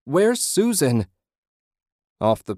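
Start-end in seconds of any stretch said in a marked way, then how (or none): 0.0-1.1 high, slow, desperate wail; anguished, pleading cry. A narrator's reading. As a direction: wail for a missing wife, drawn out. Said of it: "he wailed".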